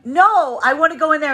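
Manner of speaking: put-on high, loud, childlike insistence; insistent protest. Masculine shut out of the castle, loudly insisting. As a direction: protest loudly at being turned away. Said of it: "Masculine's being like".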